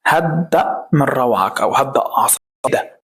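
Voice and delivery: ordinary delivery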